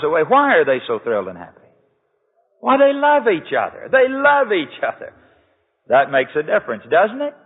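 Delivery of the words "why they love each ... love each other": excited exclamation. Real, not acted. Preacher exclaims why they're thrilled.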